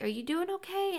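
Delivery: high-pitched imitation of a nurse checking in; high checking-in question; concerned nurse. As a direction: ask it high, like a solicitous nurse. Retells nurse checking on her.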